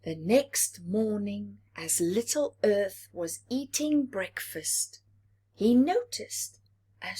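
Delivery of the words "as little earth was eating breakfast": ordinary delivery, nothing marked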